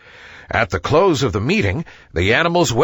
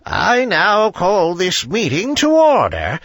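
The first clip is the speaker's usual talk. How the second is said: higher, slow owl voice calling the meeting to order; slow, stately and a little higher, like a wise old bird; 5 semitones higher than usual. A narrator's reading. Owl opening the animals' meeting, slow and stately. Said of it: "said wise old owl".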